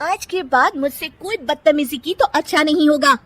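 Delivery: high, cold threat; stern warning. Acted. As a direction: warn her off for good. Nisha warns Kirti. (चेतावनी देती देवरानी)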